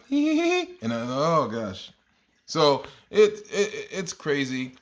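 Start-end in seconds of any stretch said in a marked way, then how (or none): 0.1-0.8 put-on giggly little girl. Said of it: "but she's like".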